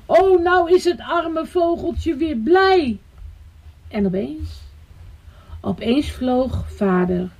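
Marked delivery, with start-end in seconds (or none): 0.1-3.0 high, drawn-out joyful exclamation; delighted little girl. A narrator's reading. Rika is happy for the little bird, delighted. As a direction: exclaim slowly with childlike delight.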